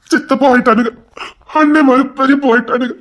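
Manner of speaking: ordinary talk, nothing marked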